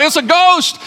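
high-pitched cry of panic; frightened cry. A narrator's reading. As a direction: yelp it out like terrified sailors. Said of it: "In their fear, they cried out".

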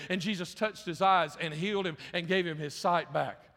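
normal talking voice

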